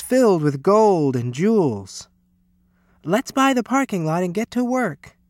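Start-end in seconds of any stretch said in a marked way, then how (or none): 3.0-5.2 high, eager rallying cry; rally them in a high voice; cried out. A narrator's reading. Teacher launching the pool plan, crying out. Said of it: "cried Miss Sievers".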